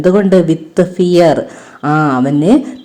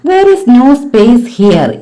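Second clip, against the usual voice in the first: higher and slow, firm little mouse; mouse insisting; 5 semitones higher, 3 dB louder, much slower than usual. Acted. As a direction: brush the fox off innocently. Mouse fools the fox. (എലി ഉറപ്പിച്ച് പറയുന്നു)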